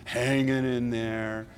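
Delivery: slow, low, resigned mumble; tired stock answer. Retold or imitated. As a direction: drag out the weary reply. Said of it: "You might say".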